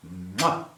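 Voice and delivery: "mwah", a big air kiss; playful smooched mwah; blown kiss. Real, not acted. Blows America a kiss.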